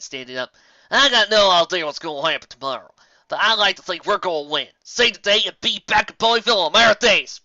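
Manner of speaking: a rousing, heartfelt speech; Applejack. Acted. Applejack voiced in story.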